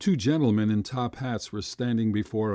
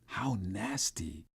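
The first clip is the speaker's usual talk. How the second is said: breathy unvoiced whisper, slow; hushed disgust under the breath; 3 semitones lower, 8 dB quieter, slower than usual. A narrator's reading. Boy whispering his disgust at oysters. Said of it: "I whispered".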